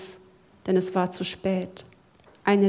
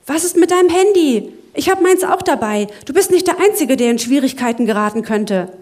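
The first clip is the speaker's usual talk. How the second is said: higher, louder panicked pleading; alarmed warning; 5 semitones higher, 7 dB louder, faster than usual. Acted. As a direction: warn him in rising panic. Zack anxiously warns his friend.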